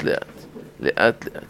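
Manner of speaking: soft, low-key repetition; slowly, gently. Shown as a demonstration. Rabbi advising teachers to explain gradually, softly. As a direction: let the words fall softly. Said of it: "תסביר כל דבר לאט".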